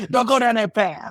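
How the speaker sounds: high-pitched, hammed-up cry of warning; high mock-alarmed holler. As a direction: call out a playful, urgent warning. Acts out warning his kids.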